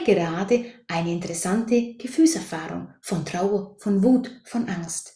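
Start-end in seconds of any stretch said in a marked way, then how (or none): none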